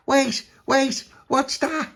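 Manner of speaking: very high, urgent puppy voice; alarmed puppy. A narrator's reading. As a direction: squeak it out in alarm. Puppy spots something.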